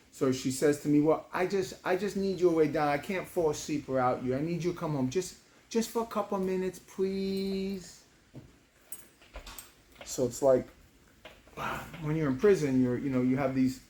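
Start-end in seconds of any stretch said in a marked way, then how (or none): 1.0-8.0 pleading daughter. Retold or imitated. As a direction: beg daddy like a small child. Daughter begs him home.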